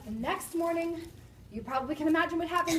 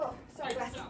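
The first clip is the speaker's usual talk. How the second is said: ordinary delivery, nothing marked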